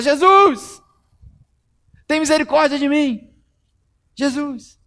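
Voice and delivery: high, loud pleading call; desperate cry. Acted. Blind man calling Jesus, high and pleading. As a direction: call out over the crowd. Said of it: "E aí ele começou a gritar".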